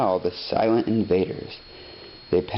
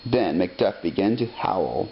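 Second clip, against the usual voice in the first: usual speaking voice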